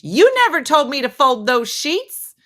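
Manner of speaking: high, loud mock protest; indignant retort. Friend protests about the sheets, indignant retort. Retold quarrel over folding sheets.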